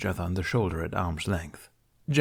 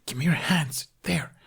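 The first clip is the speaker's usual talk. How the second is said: whisper; 7 semitones higher than usual. A narrator's reading. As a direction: hiss the cue under breath. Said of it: "whisper, unvoiced".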